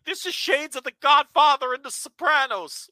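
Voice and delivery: high, loud mocking quote; mock gushing. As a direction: parody an overexcited fan's praise.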